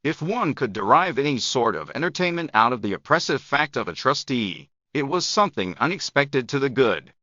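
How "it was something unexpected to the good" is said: usual speaking voice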